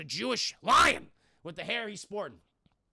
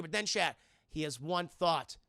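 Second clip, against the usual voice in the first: normal talking voice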